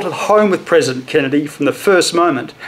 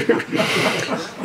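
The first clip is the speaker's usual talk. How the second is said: breathy little laugh; 4 semitones higher, 8 dB quieter than usual. Real, not acted. Amused at historical misnaming.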